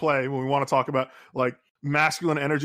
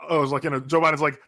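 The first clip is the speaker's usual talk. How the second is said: ordinary delivery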